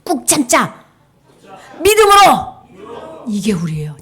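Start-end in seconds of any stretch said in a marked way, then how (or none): 1.8-3.2 slow, high, loud 믿음으로; rallying shout. Shown as a demonstration. Teacher leading children to shout the motto. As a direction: chant 믿음으로 stretched and strong. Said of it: "이렇게 손 이렇게 딱 해보세요".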